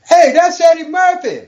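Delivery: high, loud shout from the crowd; heckler's yell. Retold or imitated. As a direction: yell it out like an audience member. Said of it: "somebody yelled out".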